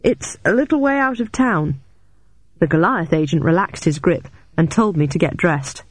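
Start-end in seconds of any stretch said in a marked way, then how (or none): 0.0-1.8 higher-pitched, strained gasp. A narrator's reading. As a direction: speak up high as if short of space. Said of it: "I gasped, trying to give myself some breathing space".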